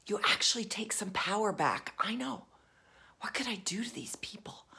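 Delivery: conspiratorial whisper. Shown as a demonstration. Coach whispering revenge plots to viewers, sly whisper. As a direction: whisper it like plotting a secret.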